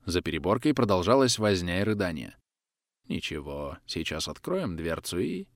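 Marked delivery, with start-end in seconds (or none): 3.1-5.5 soft, slow hushed reassurance; calm them under your breath. A narrator's reading. Quiet reassurance before storming. (тихо и медленно, успокаивающе)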